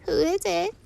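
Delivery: put-on thin, very high old granny; weak grandmother. Grandmother calls from inside the house, weak high voice. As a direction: call out feebly in a high voice. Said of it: "said a weak voice".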